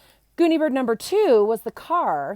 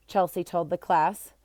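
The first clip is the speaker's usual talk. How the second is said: usual speaking voice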